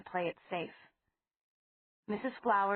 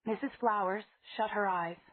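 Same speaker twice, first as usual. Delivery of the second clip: ordinary delivery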